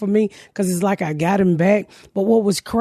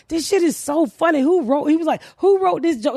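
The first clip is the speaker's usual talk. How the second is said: higher-pitched amused dad asking who wrote it; imitating a tickled dad, pitched up; mock dad voice; 8 semitones higher than usual. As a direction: play the dad, delighted, pitched up. Dad voiced laughing at joke.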